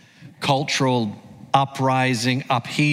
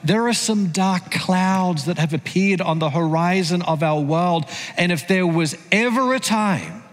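rising preacher's voice, pitched up, pushing toward the climax; building sermon; 6 semitones higher than usual. Acted. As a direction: preach it higher, growing urgent.

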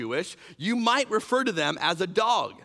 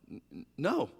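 timid stammer; 5 semitones lower, 6 dB quieter than usual. Retold or imitated. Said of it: "hesitant Gentile reply".

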